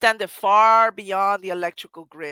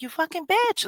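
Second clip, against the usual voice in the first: cursing; 12 semitones higher than usual. Retold or imitated. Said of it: "I was cursing her".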